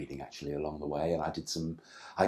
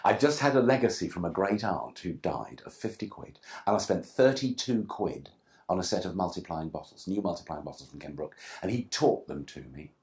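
ordinary talk, nothing marked

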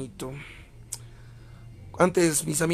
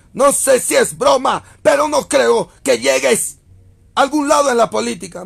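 mock shout; 7 semitones higher, 7 dB louder than usual. Reading a mocking chat comment aloud.

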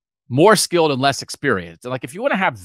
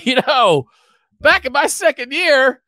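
put-on high-pitched old Southern coach telling stories; old coach's Southern accent; 3 dB louder than usual. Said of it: "we'd have a Southern accent".

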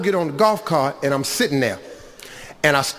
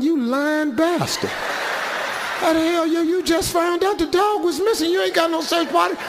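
high, indignant mock rant; lightly voiced furious tourist; mock outraged woman; 10 semitones higher than usual. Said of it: "I'm standing there going".

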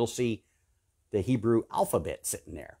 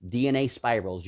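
usual speaking voice

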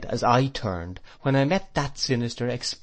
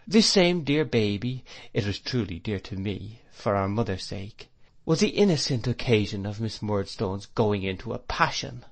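usual speaking voice

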